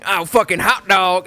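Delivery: high, loud mock bro yelling "ow, come on, man"; mocking Texan bro. Mocking how people react to Texans with a loud rowdy bro voice. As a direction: ham up a rowdy Texas guy yelping. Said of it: "and they go".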